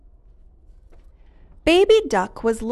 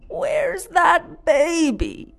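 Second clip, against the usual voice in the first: higher, slow call; 9 semitones higher, slower than usual. A narrator's reading. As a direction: call out, looking for her. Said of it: "he called".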